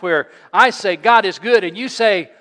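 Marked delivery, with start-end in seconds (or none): 1.0-1.6 louder call to the congregation; God is good, louder. Shown as a demonstration. Pastor leading the congregation's response, calling out louder. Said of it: "say it with a little more emphasis".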